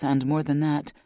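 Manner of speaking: ordinary delivery, nothing marked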